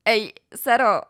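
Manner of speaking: a hint of an eager shout; calling out. A narrator's reading. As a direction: call out to Sara in delight. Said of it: "zawołała".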